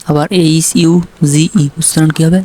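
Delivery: usual speaking voice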